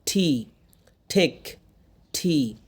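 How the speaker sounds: "ঠি, ঠেক" with puffed t; aspirated T. Shown as a demonstration. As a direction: release air on each T. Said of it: "ঠ করতেছি".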